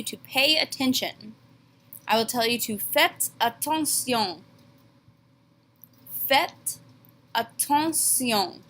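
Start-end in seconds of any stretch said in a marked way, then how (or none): 2.8-4.4 faites attention said the French way, fet ah-tahn-see-ohn; careful French classroom command; French pronunciation. Shown as a demonstration. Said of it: "Notice that the word attention is spelled the exact same way". 6.3-8.6 Faites attention, slow, French nasal on attention; slow model pronunciation for students; slow French demo. Shown as a demonstration. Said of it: "We just pronounce it differently".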